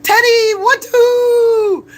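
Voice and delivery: put-on very high, loud sing-song baby talk; squealing pet voice with a whiny cry to it; baby talk to a dog. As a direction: coo up at the dog like it's a toddler.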